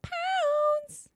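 slow, high triumphant brag; proud drawl. Real, not acted. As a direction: stretch it out proudly. Drawn-out bragging.

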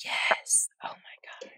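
breathy stunned whisper. Real, not acted. Reacting to the host's point about mirroring empathy, whispering. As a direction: drop to a whisper in recognition.

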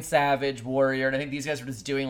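normal talking voice

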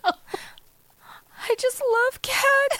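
very high, slow mock-tearful wail; hammed-up weeping, words stretched out; imitated crying. Imitating a dating-video girl declaring her love, mock crying. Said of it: "starts crying again".

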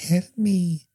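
put-on high, small child's voice; child's cry for help. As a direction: imitate a kid pleading for help. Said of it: "It does sound like a legit child".

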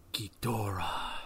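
slow, hushed movie-villain whisper; low, drawn-out dramatic whisper; imitated whisper. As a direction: draw the name out softly and ominously. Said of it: "and then he whispers".

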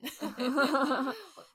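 loud amused laugh; real laughing burst; burst of laughter. Real, not acted. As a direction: laugh out at the confession. Laughs at partner's admission.

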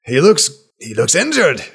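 put-on high, loud, delighted old man; overjoyed to meet an old friend; excited old healer. NPC voice.

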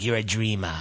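low Norwegian dad with a hint of Brooklyn; Brooklyn-like accent. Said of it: "it sort of sounds more Brooklyn".

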